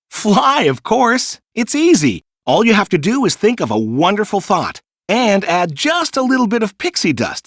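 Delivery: put-on high, breezy boyish enthusiasm; cheerful boast. A narrator's reading. Peter teaching the children to fly, high cheerful boast. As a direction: toss it off like it's the easiest thing.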